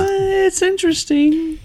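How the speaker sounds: slow, high-pitched hedging; unconvinced. Acted. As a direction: draw it out high, as faint praise. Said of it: "I'd be like".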